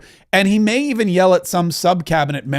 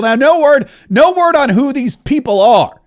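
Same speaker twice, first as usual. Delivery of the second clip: higher, louder amused aside; excited; 8 semitones higher, 5 dB louder than usual. Real, not acted. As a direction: push it up with amusement.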